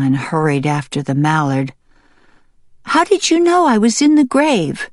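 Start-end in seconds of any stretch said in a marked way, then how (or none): 2.8-4.9 high hen voice, asking; high-pitched curious question. Acted. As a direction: ask eagerly in a high little voice. Hen questions her rescuer.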